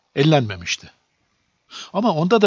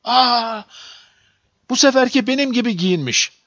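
high-pitched exclamation of surprise; astonished cry; 9 semitones higher than usual. A narrator's reading. Boy amazed by the little man in the mirror, astonished cry. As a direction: gasp an "aa", then exclaim it high, without full shouting. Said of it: "diye bağırdı".

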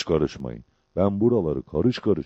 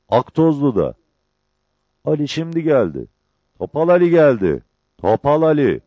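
high, loud calls with long pauses; insistent raised calls, phrase by phrase; shouting to wake him; 6 semitones higher, 6 dB louder than usual. A narrator's reading. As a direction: shout the news into a sleeper's ear. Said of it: "Kulağına eğilip birkaç kez bağırdı".

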